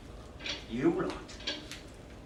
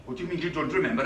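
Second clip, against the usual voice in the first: ordinary talk, nothing marked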